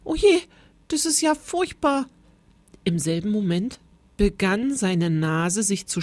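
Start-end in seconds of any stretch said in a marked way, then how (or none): 0.0-2.0 high, dismayed little-man voice; horrified. A narrator's reading. New teacher dreading the rain, high and horrified. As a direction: lament it aghast, pitched well up. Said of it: "riss entsetzt die Augen auf".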